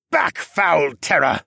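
slow, forceful heroic bellow; bellowed command. A narrator's reading. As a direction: drive the beast back with each word. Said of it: "Siskern bellowed".

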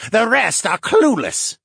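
slow, high, loud disdain; dismissive. A narrator's reading. Captive merman on his clueless people, dismissive. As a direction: write them all off, drawn out and high. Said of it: "rolled his eyes".

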